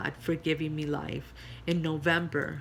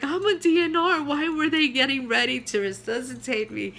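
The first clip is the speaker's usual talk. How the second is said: higher, louder urgent protest; raised voice demanding to know why; 7 semitones higher, 4 dB louder than usual. Retold or imitated. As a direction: insist on being heard. Wife protests to husband after revival.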